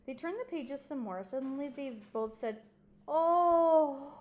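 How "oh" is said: loud, higher surprised oh; sudden realization. Acted. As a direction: gasp out the discovery.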